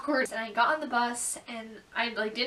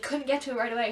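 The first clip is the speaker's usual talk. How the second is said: usual speaking voice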